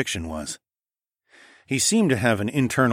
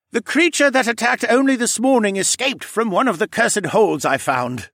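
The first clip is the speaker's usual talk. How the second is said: pleading creature voice; 13 semitones higher, 5 dB louder than usual. Acted. Cadahy speaking to the crowd.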